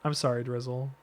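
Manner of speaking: low, soft, meek sorry; reluctant apology. Pretend. Streamer made to apologize to Drizzle, meek mumble. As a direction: give in quietly.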